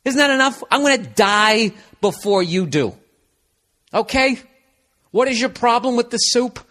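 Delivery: mock whiny retort. Boyfriend arguing about soup, high whiny mock. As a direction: whine it like a put-upon guy. Argues back, louder now.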